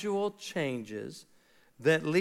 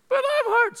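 put-on far higher, louder whimper of a hurt kid; whiny hurt child; 6 dB louder than usual. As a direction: plead like a small child who got hurt. Crying child answers father.

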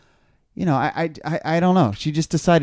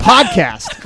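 ordinary talk

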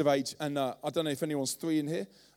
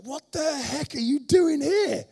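higher, louder, slow exasperated shout; half-angry disbelieving father; dad shouting in shock at his runaway son; 11 semitones higher, 8 dB louder, slower than usual. Retold or imitated. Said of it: "I saw a little bit of anger".

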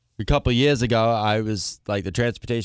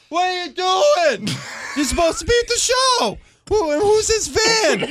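exasperated retold shout. Coordinator demanding answers from the singer in the road, exasperated shout. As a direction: holler it as a hammed-up demand.

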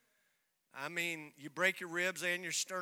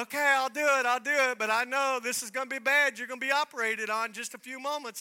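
higher, louder reluctant agreement; exasperated doctor; 6 semitones higher, 9 dB louder than usual. Retold or imitated. Doctor reluctantly agreeing to rescan, raised and insistent. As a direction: give in but insist it's bad.

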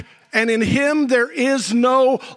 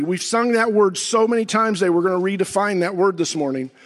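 usual speaking voice

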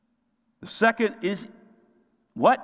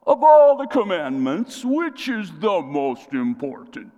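challenging questioner; 7 dB louder than usual. Acted. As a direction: put on a drawn-out challenge to a teacher. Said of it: "now they ask this question".

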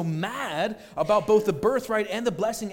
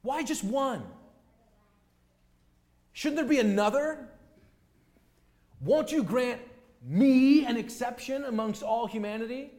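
whiny objection; 6 semitones higher, 4 dB louder than usual. Acted. Objector whining to God.

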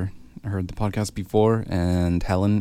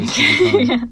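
much higher, louder, singsong sign-off; squeaky high call-out; 5 dB louder than usual. Acted. Host to listeners, squeaky high cheery sign-off. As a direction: pitch it way up like a cheery jingle.

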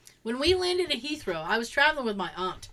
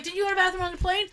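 rushed question; 6 semitones higher, much faster than usual. Retold or imitated. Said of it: "she's like".

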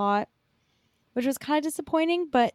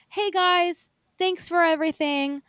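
lightly acted higher-pitched thank-you to fans; suggested stage thanks; 6 semitones higher than usual. Showing what the actor should have told fans, lightly acted. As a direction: model a quick, simple thanks from the stage. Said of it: "Just, you know".

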